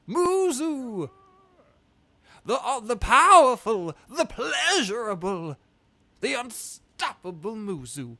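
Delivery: high, loud fanfare announcing Muzu; slow, grand mock introduction; Mushu impression. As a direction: ham it up like Eddie Murphy's Mushu. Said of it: "funny 'cause it's like Mushu".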